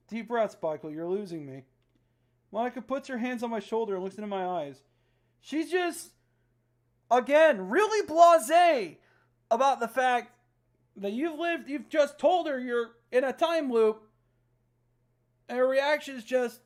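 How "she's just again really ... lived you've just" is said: loud, slow, exasperated; incredulous complaint. Real, not acted. Player complaining about Monica's blasé reaction. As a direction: complain in disbelief.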